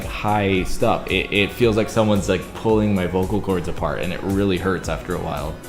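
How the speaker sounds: normal talking voice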